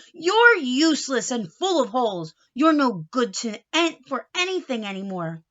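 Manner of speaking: loud angry scolding; yelling at hat. Acted. Uncle Nacho yelling at his old hat, angry and loud. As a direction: tell off the useless hat. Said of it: "began to yell at his hat".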